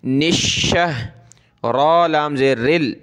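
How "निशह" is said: निशह with a long, firmly held "sh"; doubled hard sheen. Shown as a demonstration. Quran teacher showing the tashdeed, doubled hard "sh" in निशह. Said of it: "शीन को सख्त करेंगे तशदीद की वजह से".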